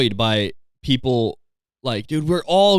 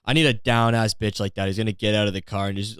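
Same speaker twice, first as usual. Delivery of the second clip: ordinary talk, nothing marked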